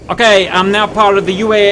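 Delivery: put-on American military pilot; hammed-up American task-force voice; American accent. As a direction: do your best American accent, reporting in. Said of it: "this is my best American accent".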